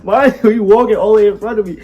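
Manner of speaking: imitating mother. Mother on FaceTime asking where her son is, mock voice. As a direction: call out like a mom checking on her son. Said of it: "She like".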